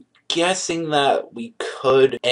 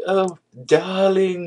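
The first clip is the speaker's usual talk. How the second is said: high, slow, sugary mock-romantic call; theatrical sweetheart voice, much higher and slower; flamboyant darling; 10 semitones higher, much slower than usual. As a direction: drawl it out like a hammed-up lovestruck girlfriend. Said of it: "like that, like, flamboyant, like, freaking romantic".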